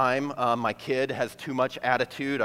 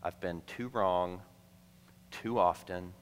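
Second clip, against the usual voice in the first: tender appeal; 4 semitones lower, 5 dB quieter than usual. Real, not acted. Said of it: "tender appeal to the unconvinced".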